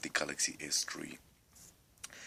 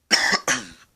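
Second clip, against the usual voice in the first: real harsh cough; sudden loud cough mid-sentence; 4 semitones higher, 15 dB louder than usual. Real, not acted. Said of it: "I'm coughing a lot".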